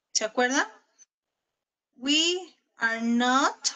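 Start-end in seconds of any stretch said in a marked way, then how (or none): 2.0-3.7 very slow, word by word for copying; slow dictation. Shown as a demonstration. Teacher dictating the negative sentence to students, slow and drawn out. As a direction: stretch each word as if writing it on the board. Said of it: "y copiamos todo lo demás".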